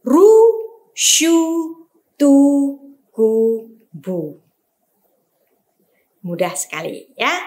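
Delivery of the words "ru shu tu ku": each syllable Ru, shu, tu, ku sounded out clearly; over enunciating. Shown as a demonstration. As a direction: read Ru, shu, tu, ku slowly, vowel u stressed. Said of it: "dibaca dengan u".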